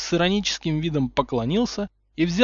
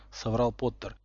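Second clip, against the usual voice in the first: plain reading voice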